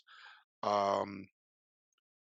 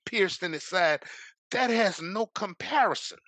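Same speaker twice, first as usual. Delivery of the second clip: louder, higher preaching build; 6 semitones higher, 6 dB louder than usual. Real, not acted. Preaching to the audience about Calvary, rising and loud.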